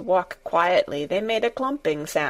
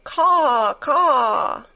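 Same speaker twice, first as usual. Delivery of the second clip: louder, higher hoarse caw-caw like a crow; 5 semitones higher, 4 dB louder than usual. Acted. Crow calls from the passage, hoarse imitated caw. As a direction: croak it out like a rook calling. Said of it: "A hoarse".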